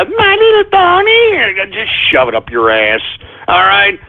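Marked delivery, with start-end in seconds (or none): 0.0-1.5 shrill mocking whine. Mocking pony fans.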